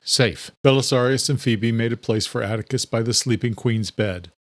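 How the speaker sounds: ordinary delivery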